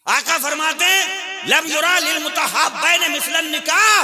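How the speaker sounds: high, loud exhortation; ringing sermon cry pitched well above normal talk; full-force preaching. Real, not acted.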